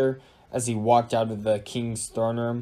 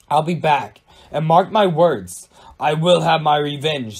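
loud, raised vow of vengeance; screamed vow; 4 semitones higher, 8 dB louder than usual. A narrator's reading. Susan being sucked into the portal, screaming. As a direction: cry it out forcefully, pitched up. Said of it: "screamed Susan".

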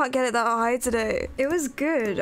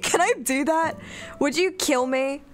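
ordinary talk, nothing marked

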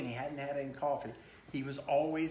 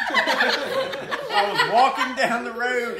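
slow, higher and louder through laughter; laughing; 6 semitones higher, 11 dB louder, much slower than usual. Real, not acted. Dad reading the donkey book, laughing through it. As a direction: squeeze the words out while cracking up. Dad cracks up mid refrain.

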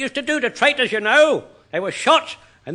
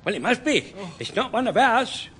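ordinary talk

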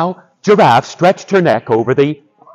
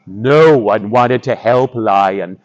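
ordinary delivery

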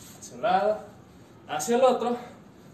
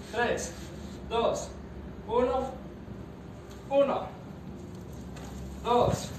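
barking gym coach; 3 semitones higher, much slower than usual. Acted. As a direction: count the reps like a drill coach. Coach counting reps aloud.

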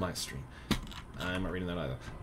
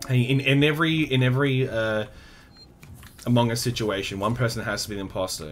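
venting loudly; 5 semitones higher, 7 dB louder than usual. Real, not acted.

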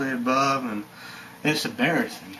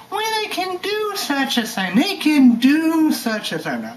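mock whiny rich people; 14 semitones higher, 4 dB louder than usual. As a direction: whine it up high like spoiled crybabies. Mocks rich people crying.

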